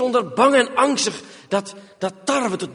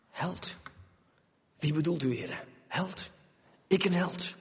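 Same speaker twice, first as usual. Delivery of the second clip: doubting whisper; 4 semitones lower, 11 dB quieter than usual. Acted. Gideon doubts the angel.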